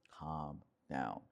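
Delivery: low, soft and settling; voice brought down. Shown as a demonstration. Tone that triggers her to calm down, low voice. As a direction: demonstrate the low DJ tone. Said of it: "bring your voice or your tonality back down".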